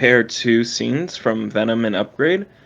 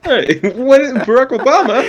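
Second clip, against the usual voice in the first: Obama impression; 12 semitones higher, 4 dB louder than usual. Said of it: "what his voice was like".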